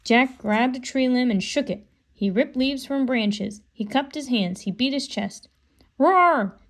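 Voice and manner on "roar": loud, high mock roar like a gorilla; a boy's hammed-up beast roar. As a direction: roar to scare off the leopard. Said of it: "he roared".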